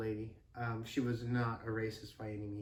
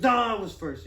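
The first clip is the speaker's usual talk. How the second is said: louder, higher mock-child contradiction; rival kid's retort; 8 semitones higher, 8 dB louder than usual. Rival kid arguing over first in line, loud retort. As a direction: hit NO loudly and argue back. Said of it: "And he goes".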